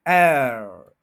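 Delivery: एयर loud, drawn out and falling; stressed AIR. Shown as a demonstration. Teacher isolating the stressed syllable, loud long एयर. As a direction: hit एयर hard and hold it. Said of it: "एआर पे stress है".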